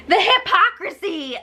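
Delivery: high-pitched indignant cry; exasperated outburst. Real, not acted. Outburst at the houseguests' fakeness.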